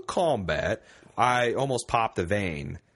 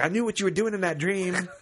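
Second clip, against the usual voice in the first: put-on higher, accusing girlfriend voice; mock accusing girlfriend; 5 semitones higher than usual. Girlfriend accusing him over a dream, mock accusing. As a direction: play the girlfriend blaming him. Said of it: "it's like".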